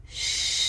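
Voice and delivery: long whispered shh; self-soothing hush. Shown as a demonstration. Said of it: "Another one can be a shh".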